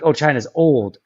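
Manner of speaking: ordinary talk, nothing marked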